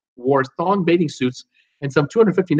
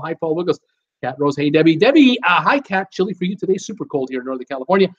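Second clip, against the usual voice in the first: ordinary talk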